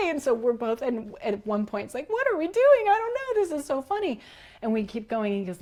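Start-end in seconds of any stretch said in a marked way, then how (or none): 2.1-4.2 high, giddy questioning; giggly disbelief. Retold or imitated. Woman retelling their lost forest walk, high and giddy. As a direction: squeal it like a giddy kid.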